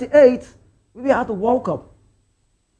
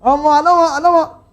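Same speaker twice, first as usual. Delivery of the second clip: laughing; 7 semitones higher, 4 dB louder, slower than usual. Real, not acted. Laughing at rival fan's boast.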